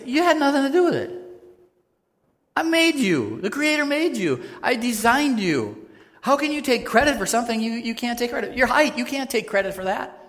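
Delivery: God scolding a proud creature, high and loud. Acted. God answers the boaster in a high, loud, booming voice. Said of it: "And God's saying".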